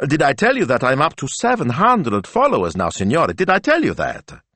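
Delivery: high, eager brag; boastful young assistant, excited. Acted. Assistant boasts of her followers to her boss, excited. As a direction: show off excitedly.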